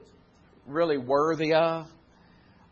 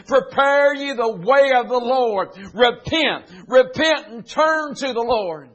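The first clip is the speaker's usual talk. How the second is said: higher, louder, slow proclamation; prophet crying out; 8 semitones higher, 7 dB louder, slower than usual. Acted. A voice in the wilderness proclaiming to the people, loud and slow. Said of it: "a voice crying in the wilderness".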